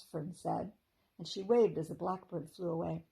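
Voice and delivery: plain reading voice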